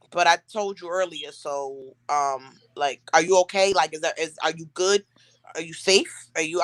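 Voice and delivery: low, hesitant imitation of a worried man; concerned boyfriend. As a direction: voice him low, checking in carefully. Retold boyfriend, puzzled, checking on her.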